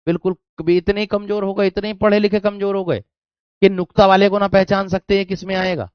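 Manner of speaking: high, rapid real telling-off; exasperated scolding. Real, not acted. Teacher to students who can't recognise nukta, exasperated scolding.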